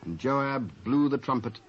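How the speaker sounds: ordinary talk, nothing marked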